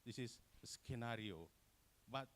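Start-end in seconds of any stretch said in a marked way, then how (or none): 0.6-1.5 skenario with a hard k after s; students' mispronunciation. Retold or imitated. Presenter imitates Indonesian students' error, hard c. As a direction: say skenario as sken-ario. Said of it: "any student of Indonesia pronounce it".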